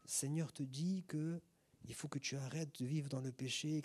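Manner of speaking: slow, softened, gentle rebuke; soft voice. Retold or imitated. Imitating a gentle brother correcting a sinner, soft voice. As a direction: imitate an overly gentle prophecy, low and slow. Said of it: "il y a une voix douce".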